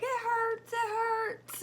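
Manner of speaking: high, loud wail of pain; mock upset child. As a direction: imitate a kid yelling that it hurts. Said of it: "He's still so mad".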